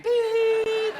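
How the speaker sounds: high monitor beep; mock hospital beep; machine beep. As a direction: imitate a flatlining monitor. Dialysis machine noise.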